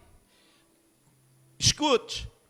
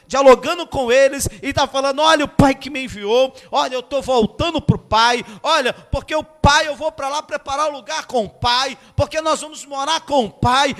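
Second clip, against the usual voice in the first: louder, higher, rushing retelling of the disciples' awe; fervent preaching; 7 semitones higher, 6 dB louder than usual. Acted. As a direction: preach it with rising excitement. Excited retelling of disciples and Jesus.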